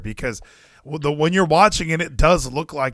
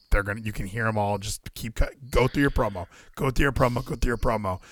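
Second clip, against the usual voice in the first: softer, fast backstage coaching; hushed urging; 5 semitones lower, 6 dB quieter than usual. Retold or imitated. Imitating Scott D'Amore coaching a wrestler backstage, hushed urging. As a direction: urge him on under your breath. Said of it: "Scott D'Amore was like".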